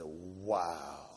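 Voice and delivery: a low, hushed, put-on wow; stunned quiet wow. Watching officers bag dope at the precinct, a hushed low wow. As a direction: breathe it out in disbelief, softly. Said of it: "I said".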